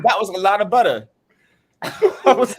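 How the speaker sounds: loud laughing fit. Real, not acted. Laughing about wasted butter.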